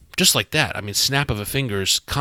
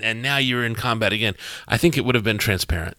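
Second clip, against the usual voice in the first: normal talking voice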